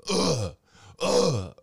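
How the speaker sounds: loud high retching sounds; mock retching. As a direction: gag like she's puking. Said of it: "You could hear it over the shower".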